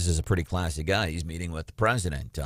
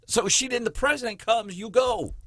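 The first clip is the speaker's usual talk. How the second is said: pitched way up, insistent; high-pitched incredulous emphasis; emphatic disbelief. Real, not acted. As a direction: exclaim it high, driving the point home.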